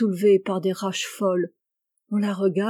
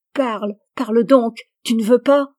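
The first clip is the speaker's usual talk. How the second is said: menacing demand; 3 semitones higher, 7 dB louder than usual. Acted. Husband burning his wife's fingers for a confession, loud menacing demand. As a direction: goad her cruelly to speak. Imagined torture by fire.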